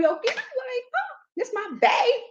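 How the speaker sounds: high, light gushing voice; hammed-up lovestruck self; mock smitten. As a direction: coo it about your sweetheart, high-pitched. Acts out her smitten self.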